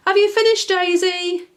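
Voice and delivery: high, loud call across the garden; father calling his daughter; calling daddy. Acted. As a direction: call out to her from afar.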